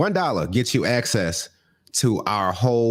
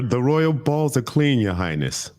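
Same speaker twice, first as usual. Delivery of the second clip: slow, mock-grand servant's address; 4 semitones higher than usual. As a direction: play the fawning servant, drawn out.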